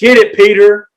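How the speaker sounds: high, loud hype-man cheer; excited, high-pitched shout of support. Pretend. Cheering Peter on during the reading.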